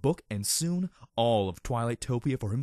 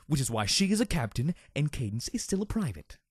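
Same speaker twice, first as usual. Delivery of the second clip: ordinary delivery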